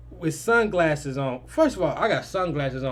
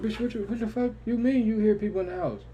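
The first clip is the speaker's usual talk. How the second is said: higher-pitched nervous retelling; 6 semitones higher than usual. Retold or imitated. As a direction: act out rattled questioning, pitch up. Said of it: "Now I'm getting nervous".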